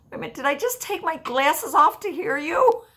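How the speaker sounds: laughing at her own glasses mix-up, high; amused surprise. Retold or imitated. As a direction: squeal it at yourself. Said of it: "I go".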